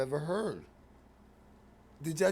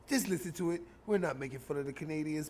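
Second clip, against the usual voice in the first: normal talking voice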